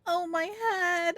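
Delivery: high, slow whimper after laughing; laughed-out groan. Real, not acted. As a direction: moan it slowly, still recovering from laughter. Worn out after laughing fit.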